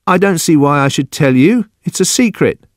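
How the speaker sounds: put-on high, childlike refusal; guard a secret in a high voice; secretive, defensive Judson. Narrator voices Judson resisting.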